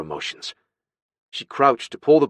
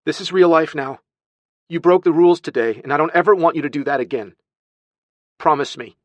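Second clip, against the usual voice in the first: loud, high, insistent telling-off; heated, raised-voice lecture; stern scolding; 7 semitones higher, 6 dB louder than usual. Acted. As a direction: demand obedience from a child. Aunt scolds, demands promise.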